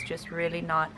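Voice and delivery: usual speaking voice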